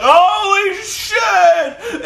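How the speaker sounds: high, very slow cry of shock; drawn-out shocked shout. Retold or imitated. Bleeding wrestler sees his wounds, a long high shocked cry. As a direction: stretch it out in horror. Retold wrestler reacting to blood.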